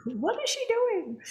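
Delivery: high-pitched imitation of puzzled onlookers; teasing incredulous question; mock bafflement. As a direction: echo it high, playfully baffled. Playfully echoes the puzzled onlookers.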